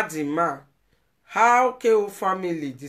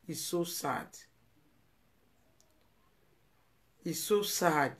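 much softer, close to tears; sadly, barely above a breath; soft, sorrowful repeat; hushed sadness; 7 dB quieter than usual. Real, not acted. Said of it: "I don't want to cry".

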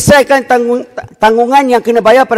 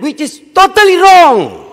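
high, slow, emphatic rebuttal; heated intonation; 7 semitones higher, slower than usual. Real, not acted. Minister rebutting the claim of a budget cut, heated. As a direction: draw it out in a raised, indignant pitch. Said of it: "saya minta intonasi tu coolkan".

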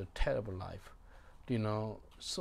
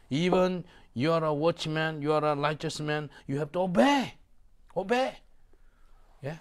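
loud, raised exhortation to obey; fervent preaching; 6 semitones higher, 7 dB louder than usual. Acted. Preacher urging the congregation to obey, loud and high. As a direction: press every obey home.

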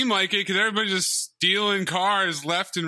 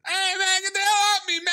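squeaky high character voice; high put-on voice; 3 dB louder than usual. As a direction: pitch way up, cartoonish guy. Mock voice of a character.

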